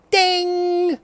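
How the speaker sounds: high sung ding; mock game show ding; ding chime. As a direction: imitate the correct-answer bell. Game show correct answer chime.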